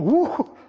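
high, loud "woo" of shock; startled whoop. Acted. Someone startled by a family member, high yelped woo. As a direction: let out a sudden jumpy whoop. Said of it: "when you get startled".

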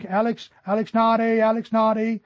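store paging call. Retold or imitated. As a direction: call out a lost child's name like a shop announcer. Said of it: "they called him".

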